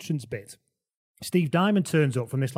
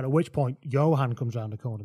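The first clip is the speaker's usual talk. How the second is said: ordinary talk, nothing marked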